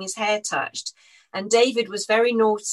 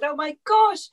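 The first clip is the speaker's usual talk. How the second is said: much higher and louder, overjoyed; thrilled gasp; 9 semitones higher, 4 dB louder than usual. Retold or imitated. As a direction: burst out in delight. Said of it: "And I said".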